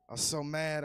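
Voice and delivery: usual speaking voice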